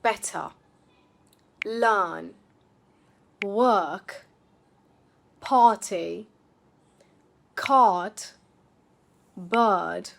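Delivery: BETTER, LEARN, WORK with silent R and long vowel; non-rhotic British model words. Shown as a demonstration. Teacher modelling words for repetition, non-rhotic. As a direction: say PARTY, CART, BIRD one by one, no R. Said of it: "I want you to repeat these words after me".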